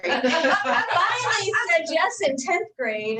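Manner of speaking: laughing through the words, slowed; amused at the memory, chuckling as she says it; slow, laughing aside about her husband. Real, not acted. Teasing story about husband courting.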